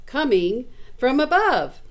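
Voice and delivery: plain reading voice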